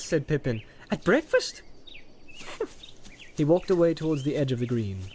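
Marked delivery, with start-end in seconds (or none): 0.9-1.6 much higher incredulous question; amazed question. Acted. 1.7-3.3 airy high-pitched giggle; high breathy laugh. Acted. Pippin laughing.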